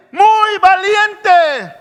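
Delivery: high, loud, very slow "muy valiente"; stretched command. Acted. God repeating his command to Joshua, loud and drawn out. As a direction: drag out each syllable for emphasis.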